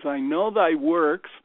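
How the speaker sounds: slow, weighty Bible quotation; measured, solemn declaiming of a verse; scripture recitation. Acted. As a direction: recite scripture slowly and emphatically. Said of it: "there's this quote that says".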